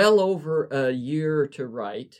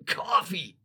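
scratchy word forced out after a cough, pitched up; 4 semitones higher than usual. Acted. Poet croaks his order into the intercom, scratchy cough. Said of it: "I tried to cough out".

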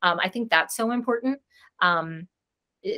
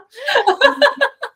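high, loud burst of laughter; real high giggle; 9 dB louder than usual. Real, not acted. Laughing after her own quote.

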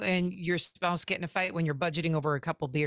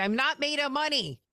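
exasperated parent; 7 semitones higher than usual. Retold or imitated. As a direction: say it like a fed-up parent. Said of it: "when I say".

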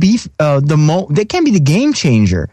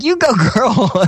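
cheering on; 8 semitones higher than usual. Retold or imitated. Cheering a friend on.

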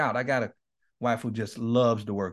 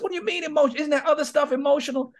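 high, pitched-up imitation of a confused client; mock puzzled client; 13 semitones higher, faster than usual. Counselor voicing his puzzled client, high mock confusion. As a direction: play the baffled client pushing back.

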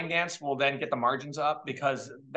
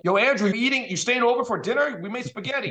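put-on loud, high Italian-American mom accent; 8 semitones higher, 5 dB louder than usual. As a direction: call out like a New Jersey Italian mother. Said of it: "spoke in very thick Italian accent".

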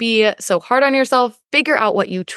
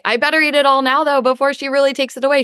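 rushed, higher-pitched mock panic; urgent inner voice; 5 semitones higher, faster than usual. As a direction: hurry it out before it gets taken away. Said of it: "Get it in quicker".

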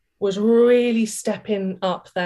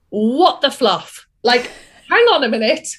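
1 mock outraged disbelief; 4 semitones higher, 5 dB louder than usual. Retold shocked outburst.

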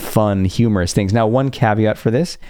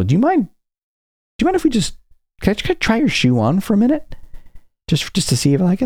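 high, sugary mock plea; hammed-up innocent request, pitched way up; mock sweet asking; 11 semitones higher, faster than usual. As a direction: coax him in a much higher, innocent voice. Retold asking stranger sweetly.

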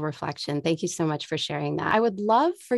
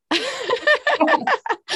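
bright rising laugh; high laugh; 3 dB louder than usual. Real, not acted. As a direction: laugh at your own line. Laughing at own remark.

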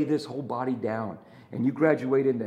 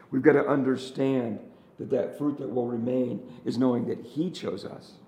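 normal talking voice

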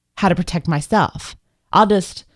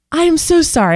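flustered apology; 9 semitones higher than usual. Retold or imitated. Retold panicked apology.

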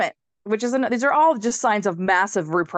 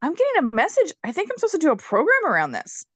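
surprised realization; 7 semitones higher, faster than usual. Retold or imitated. Retelling a sudden insight.

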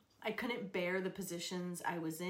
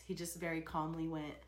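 ordinary delivery

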